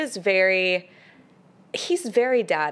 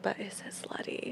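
whispered, much softer, sly; mock whisper; 15 dB quieter than usual. Friend joking about asking people, whispered. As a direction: breathe it like a naughty secret. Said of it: "I'm just gonna be like".

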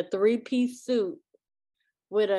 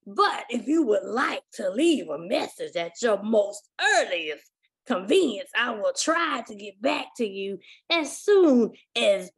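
put-on old pastor voicemail voice; slower than usual. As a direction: play an old pastor, slow and grand. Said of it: "he sounds like an old ass man".

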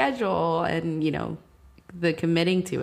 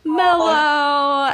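drawn-out word, raised and laughing; mellow; 10 semitones higher, 7 dB louder than usual. Shown as a demonstration. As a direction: stretch it out long and playful. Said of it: "it's kind of, like, mellow".

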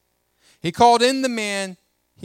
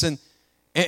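ordinary talk, nothing marked

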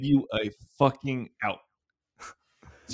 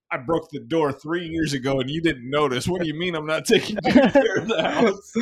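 indignant retort; 5 semitones higher, 6 dB louder than usual. Retold or imitated. Retold argument with mom.

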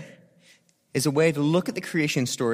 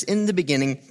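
solemn scripture reading; 6 semitones lower than usual. Acted. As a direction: read it low and reverent, like a poem. Reads Genesis one as poem.

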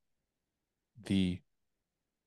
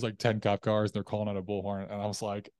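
ordinary talk, nothing marked

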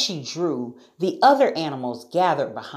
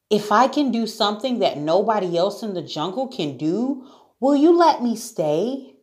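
high, quick, hopeful bargain; earnest little-girl plea; pleading Sarah; 7 semitones higher than usual. A narrator's reading. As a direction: bargain eagerly, rising higher at the end. Sarah bargains for her life.

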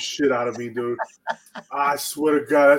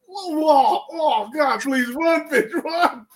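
high-pitched panicked pleading; scared viewer yelling at the screen; retold fright; 13 semitones higher, 3 dB louder, much slower than usual. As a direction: urge her to flee in a panic. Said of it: "scared the shit out of me".

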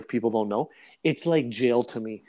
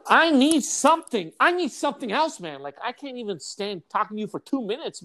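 exasperated mock plea; 9 semitones higher than usual. Friend begging him to be less boring, exasperated. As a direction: plead in a hammed-up high voice.

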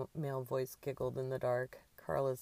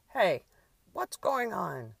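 loud, high alarmed shout; 6 semitones higher, 9 dB louder, slower than usual. A narrator's reading. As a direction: call out into the night. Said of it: "Shouts of".

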